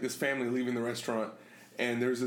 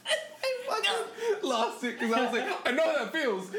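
high voice squeezed by laughter; words breaking into real laughter; laughing hard; 11 semitones higher than usual. Real, not acted. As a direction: talk right through the giggles. Still laughing over own story.

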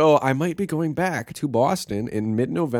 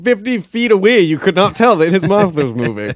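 loud teasing; 8 semitones higher, 8 dB louder than usual. Real, not acted.

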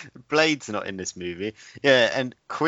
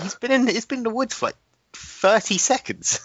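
much higher, fast mock disbelief; exasperated; 14 semitones higher than usual. Protesting the radio guy's line to a co-host, high and exasperated. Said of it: "I was like".

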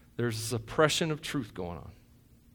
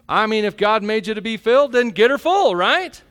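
louder and higher, driving the point home; full-force preaching; 7 semitones higher, 8 dB louder than usual. Acted. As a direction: preach it hard to the congregation.

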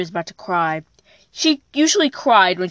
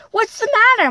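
much higher, mother's worried question; a mom asking her child what's wrong, higher voice; 12 semitones higher, 3 dB louder, faster than usual. Acted.

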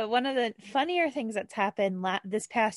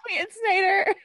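giggly gush; 12 semitones higher, 5 dB louder than usual. Real, not acted. Gushing over a celebrity crush.

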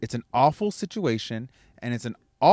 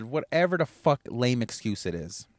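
ordinary talk, nothing marked

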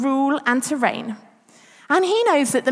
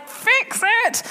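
mock exasperated demand; 9 semitones higher than usual. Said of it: "I'm just like".

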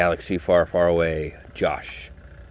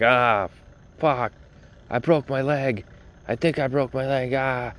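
higher-pitched imitation of an injured friend's groaning; mock pained cry, pitched up; hurt buddy; 7 semitones higher than usual. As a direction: imitate your buddy wailing in pain from a distance. Imitates hurt buddy yelling in background.

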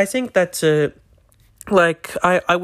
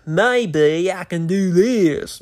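bad American accent; 3 semitones higher, much slower than usual. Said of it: "it's better than that".

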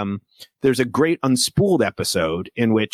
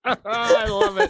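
laughing delight; slower than usual. Real, not acted. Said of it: "Keep it going".

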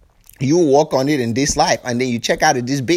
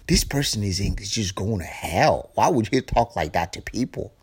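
judgy churchgoer; 7 semitones lower, 7 dB quieter than usual. Retold or imitated. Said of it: "And you like".

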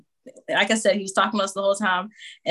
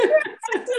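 high, bubbling giggle; laughing at herself. Real, not acted. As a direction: let a giddy high laugh out. Laugh after the quote.

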